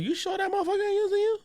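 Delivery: way up high and quick, imitating mom's suspicion; suspicious mom voice. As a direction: squeak it like a nosy mom grilling her son. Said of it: "She'd be like".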